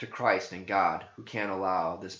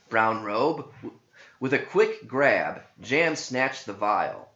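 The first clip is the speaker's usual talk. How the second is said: ordinary delivery, nothing marked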